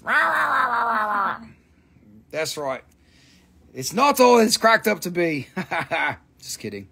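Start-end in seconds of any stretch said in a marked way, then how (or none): 0.0-1.5 mock ranting gibberish, starting high and falling; ranting husband. Seller mimics a husband's wordless nagging, mock rant. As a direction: imitate a husband yapping on and on. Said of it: "like a good husband does".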